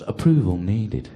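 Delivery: slow, loud machine announcement; self-checkout machine. Retold or imitated. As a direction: announce it like a self-service till. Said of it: "and it goes".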